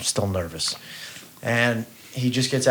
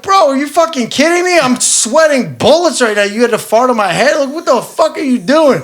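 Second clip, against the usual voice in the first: much higher, louder, laughing outrage; exasperated yell; 11 dB louder than usual. Retold or imitated. Son yelling at his father over a fart prank. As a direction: blow up at the prank in disbelief. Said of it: "I'm like".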